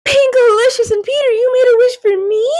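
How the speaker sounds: touched. A narrator's reading. Rocky moved by wish.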